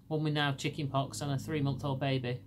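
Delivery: ordinary talk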